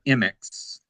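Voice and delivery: M X said as "em-ex", no leading eye sound; correct pronunciation. Shown as a demonstration. Guest giving interviewer the group's own pronunciation, M X as "em-ex". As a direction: say M X as two letters, "em-ex". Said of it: "that's how they pronounce it".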